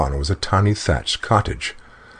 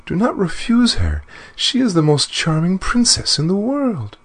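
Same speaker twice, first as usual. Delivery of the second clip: high, urgent pleading; distressed plea. Acted. Queen begging the dwarf to take Bellissima, high distressed plea. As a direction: plead anxiously for her daughter. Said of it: "said the queen in great distress".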